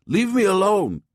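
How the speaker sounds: put-on high shout of an old man. A narrator's reading. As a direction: shout to push him off. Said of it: "Don Juan shouted".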